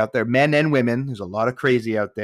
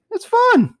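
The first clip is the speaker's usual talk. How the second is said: much higher and louder, a sudden squeal; gleeful squeal; 7 dB louder than usual. Retold or imitated. Picturing single life again, a giddy squeal.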